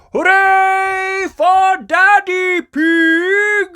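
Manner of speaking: high, drawn-out cheering shout; crowd cheer. A narrator's reading. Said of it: "and the crowd cheered".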